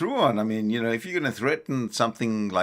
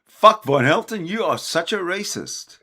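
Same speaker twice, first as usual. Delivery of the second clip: mock heckle; 7 semitones higher, 5 dB louder than usual. Cohost mock-heckles the host.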